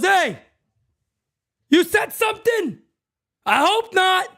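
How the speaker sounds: high taunting umpire voice; mocking umpire. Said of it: "an umpire looks at you and goes".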